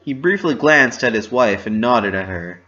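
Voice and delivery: ordinary talk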